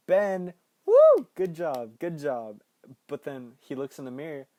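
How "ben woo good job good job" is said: loud teasing whoop at the screen; mock cheering. Viewer cheering Ben's kiss, loud playful whoop. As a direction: cheer Ben on like a rowdy friend. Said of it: "And you're like".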